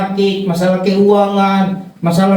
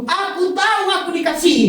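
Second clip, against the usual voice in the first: imitated heckler; 7 semitones higher, 4 dB quieter than usual. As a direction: play a congregant piping up, higher-pitched. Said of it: "Untung tidak ada yang nyeletuk". (menirukan jemaat nyeletuk dengan nada tinggi)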